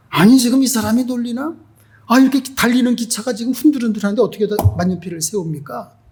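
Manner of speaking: high-pitched, quickening protest; indignant disbelief. Retold or imitated. Passenger protests the pen trick on a moving train, indignant. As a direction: act out someone who feels mocked. Acted passenger scoffing at missionary.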